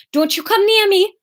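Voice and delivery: put-on high, fierce warning in Newcastle accent. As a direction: warn him off, slipping into Geordie. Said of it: "No, that's still Newcastle".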